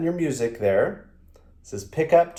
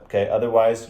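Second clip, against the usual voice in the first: ordinary talk, nothing marked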